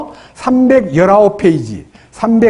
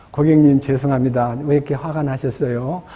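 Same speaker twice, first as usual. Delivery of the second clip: soft, low apologetic reply; calm the angry customer softly; gentle answer; 5 semitones lower, 5 dB quieter than usual. Retold or imitated. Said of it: "하고 부드럽게 말하면". (낮고 부드럽게 사과하는 말투)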